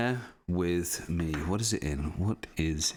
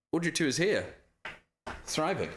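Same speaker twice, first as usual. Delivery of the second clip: ordinary talk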